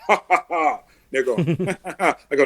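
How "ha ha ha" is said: booming hero's laugh, chest out; heroic laugh. Shown as a demonstration. Coach shows clients how a hero laughs, heroic laugh. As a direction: laugh like Superman at your problem. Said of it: "laugh like this".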